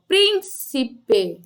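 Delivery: slow, split "prín-ci-pe" into its syllables. Shown as a demonstration. Teacher separating syllables for pupils, slow and segmented "príncipe". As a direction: draw out "príncipe" one syllable at a time. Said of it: "você pode separar as sílabas da palavra".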